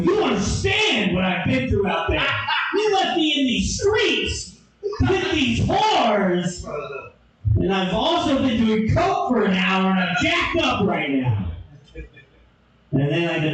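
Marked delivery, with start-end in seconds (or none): 0.0-11.5 high, shouted defence; mock frantic excuse; worked-up rant. Defending himself to girlfriend.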